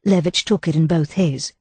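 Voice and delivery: plain reading voice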